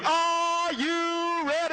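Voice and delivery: slow, drawn-out high announcer call; mock ring announcer build-up. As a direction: stretch it like a boxing announcer.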